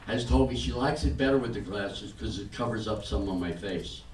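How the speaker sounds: normal talking voice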